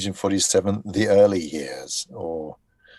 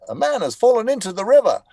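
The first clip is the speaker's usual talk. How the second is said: put-on much higher, louder young announcer voice; high-pitched young voice; 12 semitones higher, 7 dB louder than usual. Shown as a demonstration. Voice actor redoing his famous Lego City line, high and loud. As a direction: push it up to sound young and bright. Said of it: "younger voice that was really kind of, high pitched".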